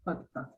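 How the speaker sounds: "hut, hut" mouth nearly closed, short lax u. Shown as a demonstration. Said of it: "You say hut".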